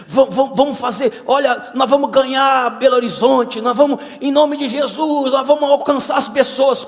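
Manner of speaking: high, stammering burst of enthusiasm; rally everyone like an overexcited disciple; fired-up zeal. Acted. Switched-on Tomé promising to win Belo Horizonte, fired-up and eager. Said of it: "Quando Tomé tá conectado".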